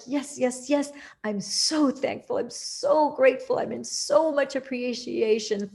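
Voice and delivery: high, rising cries of thrilled gratitude; joyful thanks. Pretend. Imagined self thanking God after manifesting, joyfully high.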